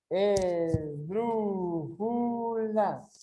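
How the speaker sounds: very slow, every syllable stretched out. Shown as a demonstration. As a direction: draw each syllable right out. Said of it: "separa em sílabas". (sílaba por sílaba, bem devagar)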